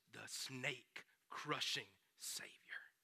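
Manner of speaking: drawn-out whispered reveal; slow whisper. Acted. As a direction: whisper the title slowly. God's promise ends in hush.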